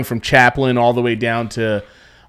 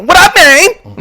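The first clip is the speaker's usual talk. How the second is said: very high, loud, excited squeal; Chris Tucker impression; 10 dB louder, slower than usual. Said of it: "electric and all over the place".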